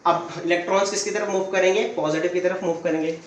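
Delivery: usual speaking voice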